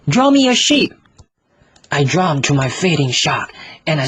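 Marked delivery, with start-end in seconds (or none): 0.1-0.9 put-on much higher and louder child voice, stress on me; insistent little boy. Little prince repeating to the pilot, high insistent child. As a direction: insist like a small stubborn child.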